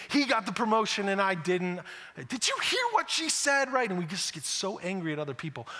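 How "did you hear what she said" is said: high, slow gossip voice; catty office gossip; gossipy whisper-shout. Acted. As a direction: lean in and dish the dirt, pitched way up. Gossiping about a coworker.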